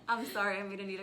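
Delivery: loud apology through laughter; laughing. Real, not acted. Both laughing, close to tears.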